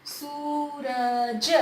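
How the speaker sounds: सूरज very slow, each sound stretched; drawn-out reading. Shown as a demonstration. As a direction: spell सूरज out slowly by sound. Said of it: "जिस मात्रा को खींचा जाएगा वो बड़ी".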